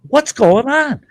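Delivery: high startled ask; surprised question to Brian. Retold or imitated. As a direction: ask it baffled.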